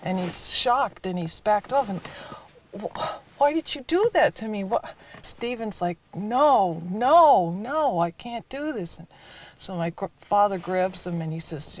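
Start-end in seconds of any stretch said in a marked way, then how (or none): 2.8-4.9 imitating a shocked, hurt boy, pitched high; high-pitched retold cry of betrayal; shocked protest. Wounded boy to his attacker, in high shocked protest. 6.1-9.0 imitating a panicked boy, higher with a loud second no; retold pleading refusal, voice swooping up; distressed refusal. Boy refusing his father's order, in distressed protest.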